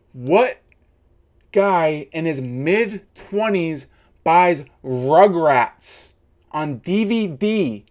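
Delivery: slow, heavy words; slow mocking emphasis. Real, not acted. Ribbing a rival youtuber, slow pointed emphasis. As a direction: drag each point out to mock him.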